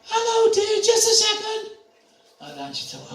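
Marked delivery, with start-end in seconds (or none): 0.0-1.7 put-on much higher, louder sweet old lady calling out; sweet elderly lady's high call. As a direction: call through the door like a frail old lady. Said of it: "I heard a voice go".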